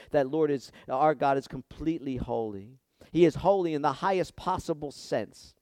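ordinary delivery

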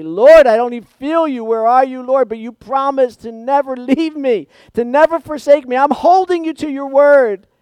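crying out loud and high to God, pleading; a desperate believer shouting his prayer to the Lord. Retold or imitated. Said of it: "and you say".

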